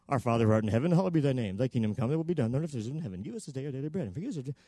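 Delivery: low, soft recited prayer, trailing away; long rote prayer. Shown as a demonstration. Preacher showing the prayer Peter had no time for, rote recital. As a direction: drone it low and hurry on, fading out. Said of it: "He doesn't have time for this".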